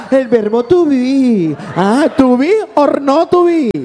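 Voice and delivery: high, slow pompous recital; a high showy voice reciting English; mock show-off English. (voz alta y pomposa, presumiendo inglés)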